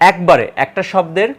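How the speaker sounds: ordinary talk, nothing marked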